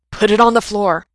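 loud urgent order; cried out. A narrator's reading. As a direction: shout the instruction across the room. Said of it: "Mikey cried".

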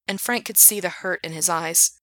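plain reading voice

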